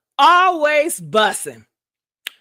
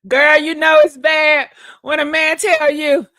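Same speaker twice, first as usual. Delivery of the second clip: ordinary delivery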